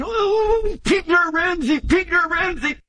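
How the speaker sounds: high, shouted fake cursing of a name; mock curse after hitting thumb. Preacher mocking a hurt man's outburst, high shout. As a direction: yelp it like someone who just hit their thumb. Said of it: "jumping up and down".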